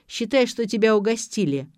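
ordinary delivery

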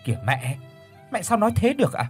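mild snap. Acted. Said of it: "Ngọc Ngà gắt nhẹ".

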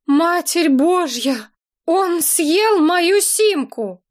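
high, slow cry of horror; each word dragged out in disbelief; horrified. A narrator's reading. Woman staring at the puppy that swallowed her SIM, high slow horrified cry. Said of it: "в ужасе пялилась".

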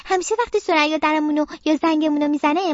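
ordinary delivery, nothing marked

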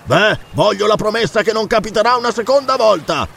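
high, insistent calling; shouted demand. Acted. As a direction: demand it across the distance. Pirate shouts demand to the fort.

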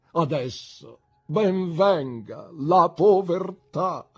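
loud, high, grandiose oratory; impassioned, swelling theatrical speech; tearful declaiming. A narrator's reading. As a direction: declaim it like a melodramatic hero. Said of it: "esclamò tra le lacrime".

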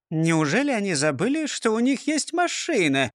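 wondering mutter rising high; mildly baffled question; puzzled bystander. A narrator's reading. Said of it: "пробормотал другой".

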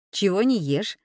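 ordinary delivery

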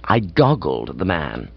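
plain reading voice

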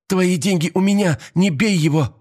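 high pleading cry; desperate shout. A narrator's reading. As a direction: plead high and urgent for his sake. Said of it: "крикнула Мария".

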